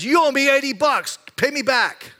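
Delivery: high, loud mock demand for eighty bucks. Ungrateful servant demanding his debt, mock outrage. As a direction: ham up the petty creditor. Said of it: "goes to this other guy and says".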